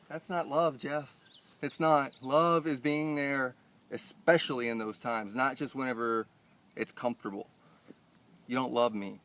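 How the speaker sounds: loud, pointed scolding; heated rant. Retold or imitated. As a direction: insist on each line.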